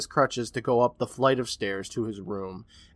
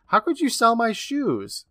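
high, slow mock yell of outrage; 9 semitones higher, slower than usual. Nephew yelling at his uncle, high and slow. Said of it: "And Bobby yells".

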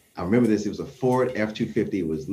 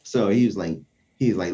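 ordinary delivery

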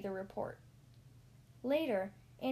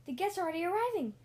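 fast, higher squeaky alarm; squeaked; 7 semitones higher, 4 dB louder, much faster than usual. Acted. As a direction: squeak it out in a rush. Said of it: "squeaked Ham the pig".

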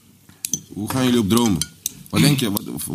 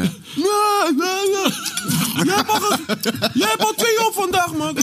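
very high-pitched mock scream with laughter in it; imitated screaming; much slower than usual. Storyteller mimics young Jonah fighting over a tosti, screaming in high pitch. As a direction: squeal it out in a far higher imitation voice.

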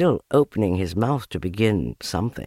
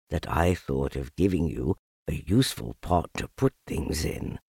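low bear, a touch pleased; mildly glad, kept low; happy Pooh; 6 semitones lower, 4 dB quieter than usual. A narrator's reading. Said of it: "said Pooh happily".

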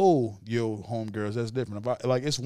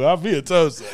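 laughing; 3 semitones higher, 3 dB louder than usual. Real, not acted. Echo with laugh.

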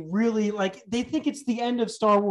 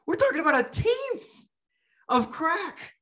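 much higher, fast disbelief; incredulous; 9 semitones higher than usual. Real, not acted. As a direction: squeak it out in outrage. Incredulous rant about tiny amount.

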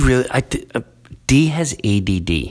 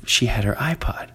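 whisper; 5 dB quieter than usual. Real, not acted. Gossiping aside.

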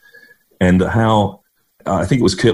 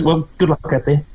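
scorebook talk; 7 semitones higher than usual. Retold or imitated. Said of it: "talk like that all the time".

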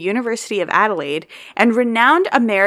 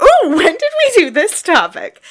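high, loud, hammed-up wonder; pretend surprise; 9 semitones higher, 6 dB louder than usual. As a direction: act as if it's all news to you. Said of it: "I had to pretend like I hadn't heard it".

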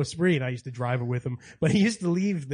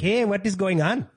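slow, higher British-Indian-New York blend; mixed British-Indian-American accent; 5 semitones higher, slower than usual. Acted. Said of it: "he has the weirdest accent".

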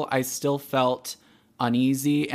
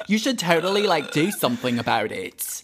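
a hint of Aussie twang urging her on; Australian accent; 2 semitones higher than usual. Friend urging action in a light Australian accent. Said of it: "these intense fucking Australian accents".

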